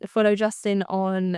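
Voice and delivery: ordinary delivery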